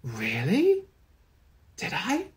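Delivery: soft, wondering disbelief; imitating dad's childlike surprise; innocent surprise. Dad learning he ran a factory, soft and wondering. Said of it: "he was like".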